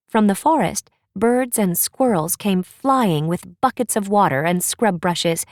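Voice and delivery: ordinary delivery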